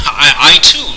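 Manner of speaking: loud, high start after a laugh; amused. A narrator's reading. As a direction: let a chuckle carry into the words. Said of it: "Pendulum's voice was amused".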